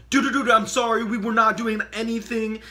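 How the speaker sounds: high, fast, loud apology; panicked pleading. Retold or imitated. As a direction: plead for your life, mock-terrified. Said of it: "I'm sitting there like".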